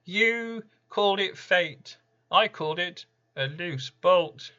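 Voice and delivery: high, loud, uncanny retort; Random's retort. Acted. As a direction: shrug off the blame as a spirit. Random answers the listener.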